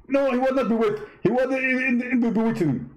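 high-pitched mock debater voice; a squeaky, smug rival preacher; Zakir Naik impression. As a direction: push the voice way up and lampoon him.

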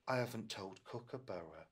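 ordinary delivery

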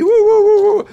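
high, loud giddy hooting; excited whooping. Retold or imitated. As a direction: whoop with joy at arriving. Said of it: "So I was really".

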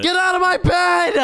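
high-pitched angry yell; outraged yell. Acted. Ordering the boss out of the bed, outraged yell. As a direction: yell at the intruder to clear off.

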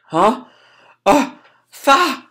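rising high gasps, cut short; acted horror. Boy spots father in blood, acted horror. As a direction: start a cry and choke it off. Boy discovers dead parents.

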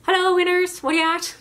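a cheery Newfoundland phone greeting; Newfoundland accent. Retold or imitated. Cashier answers the store phone in a Newfoundland accent. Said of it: "a Newfoundland East Coast accent".

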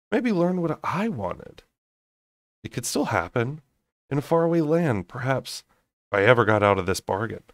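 plain reading voice

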